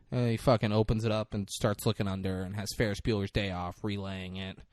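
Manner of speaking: ordinary delivery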